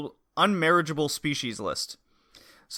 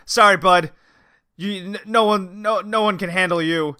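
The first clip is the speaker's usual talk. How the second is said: blunt fairy; 5 semitones higher, 6 dB louder than usual. Retold or imitated. Fairy rejects angel at desk.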